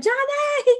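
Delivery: high-pitched calling-out of a name; high, drawn cry for a friend; mock yell. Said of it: "You better yell my name".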